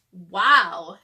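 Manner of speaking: a big impressed wow. Acted. Wilson impressed by cookie shapes.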